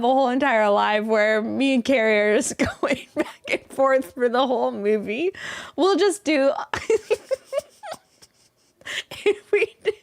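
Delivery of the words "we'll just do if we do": high giggly laughing words. Real, not acted. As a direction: crack up mid-sentence. Cracking up mid-sentence.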